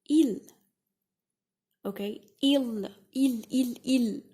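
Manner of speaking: "il" said loud and drilled; French il repeated. Shown as a demonstration. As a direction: hammer out "il" like a drill. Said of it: "you say".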